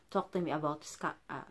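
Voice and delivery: ordinary delivery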